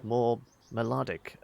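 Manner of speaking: usual speaking voice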